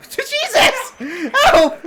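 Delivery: high startled cry for help; startled yell. Real, not acted. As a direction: yelp in sudden fright. Startled by ghosts in game.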